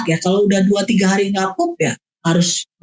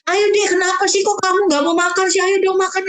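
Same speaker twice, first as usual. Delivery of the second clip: much higher, whiny, long-winded nagging; nagging mom; 10 semitones higher than usual. Shown as a demonstration. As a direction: plead and nag at the child. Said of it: "Jangan begini".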